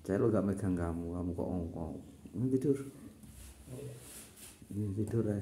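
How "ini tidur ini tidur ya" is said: coaxing pet to sleep. Real, not acted. Owner urging pet Lan to sleep, high lilting coax. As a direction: croon it up high to settle the animal.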